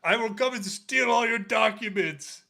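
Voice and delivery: high, loud mock menace; mock threatening caller. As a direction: ham up a threatening voice note. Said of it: "one of the voices was".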